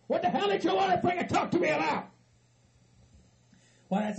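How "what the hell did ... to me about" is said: high-pitched, irritated demand; exasperated outburst. Acted. Exasperated at his visitors.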